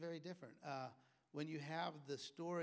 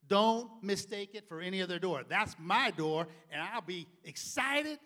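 threatening dad; 5 semitones higher, 15 dB louder than usual. Retold or imitated. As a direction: dare the kid to come. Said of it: "He goes".

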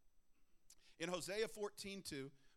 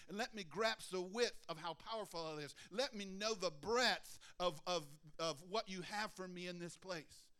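ordinary talk, nothing marked

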